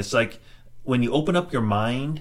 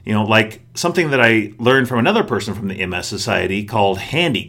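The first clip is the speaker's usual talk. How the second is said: podcast outro; 6 dB louder than usual. Acted. Host's outro read.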